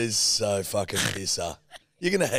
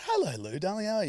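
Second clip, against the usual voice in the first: lightly voiced doting mum; a hint of mum's sing-song greeting, rising high; 9 semitones higher than usual. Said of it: "She said".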